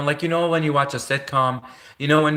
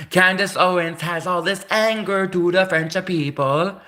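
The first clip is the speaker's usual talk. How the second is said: hammed-up Italian accent, higher; a put-on Italian accent; mock Italian accent; 4 semitones higher than usual. Shown as a demonstration. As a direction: play her as an Italian who dislikes the French. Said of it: "let's pretend she's an Italian".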